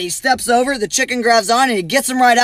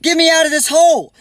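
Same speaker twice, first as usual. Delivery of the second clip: mock yell; 6 semitones higher than usual. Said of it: "he yelled to the chicken".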